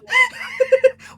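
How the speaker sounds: high, loud burst of laughter mid-quote; laughing. Retold or imitated. Cracking up while retelling the message to Casey, loud laugh. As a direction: laugh at her own dramatics. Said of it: "And I was like".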